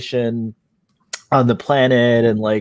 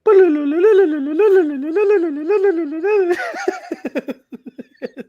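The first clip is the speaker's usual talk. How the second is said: long high giggling laugh; can't stop laughing, rising high; helpless high-pitched laughter. Real, not acted. Guest bursts out laughing.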